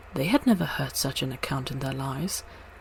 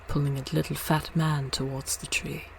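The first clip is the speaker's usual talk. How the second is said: plain reading voice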